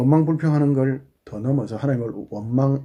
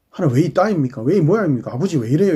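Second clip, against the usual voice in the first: higher, insistent wail of grievance; protesting complaint; 3 semitones higher, 4 dB louder than usual. Acted. Believer complains to God the Father, indignant. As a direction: demand an explanation in rising and falling waves.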